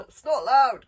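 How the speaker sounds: huge high bellow of the name; calling out. A narrator's reading. Gobber calling to his pupil in battle, loud bellow. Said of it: "called Gobber".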